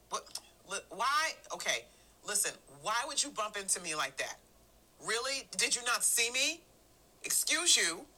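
annoyed at-home voice. Acted. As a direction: act put out with family who bumped you. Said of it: "I may sound something like this".

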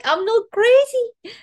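high, loud, slow relieved cry; relieved burst. Retold or imitated. Child realizing she isn't crazy, high and loud.

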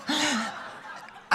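high burst of laughter; real laugh. Real, not acted. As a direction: laugh out at the awkward ending. Laughing at the story.

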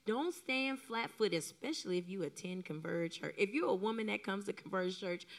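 normal talking voice

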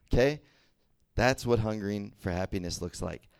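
ordinary talk, nothing marked

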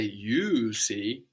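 "you" stretched and stressed, "see" falling after it; emphasized YOU. Shown as a demonstration. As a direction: put the weight on "you". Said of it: "and we emphasize you".

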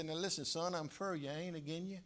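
quiet, tender fatherly voice; sincere fatherly. Retold or imitated. Father reassuring his son, soft and sincere. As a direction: soften down and reassure the boy. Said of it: "so sincere".

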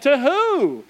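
high, incredulous question; retold baffled husband; incredulous retort. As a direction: shoot it back high in disbelief. Retold retort to wailing wife.

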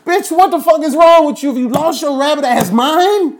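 put-on yelling at a little girl who slapped him; furious shout. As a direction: roar it like you just got slapped. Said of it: "I'm like".

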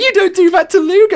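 high, loud mock outrage; indignant friends. Angry partygoers telling off the headbutter. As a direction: protest on Luke's behalf. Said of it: "people were getting, like, pissed".

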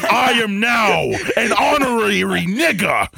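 high, drawn-out wailing proclamation; mock activist outcry. Parodying empty activism for co-hosts, hammed-up wail. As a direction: shriek it like a hammed-up crusader.

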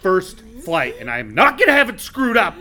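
hammed-up captain losing his temper; angry rant. Captain ranting at the couple about his first flight, loud.

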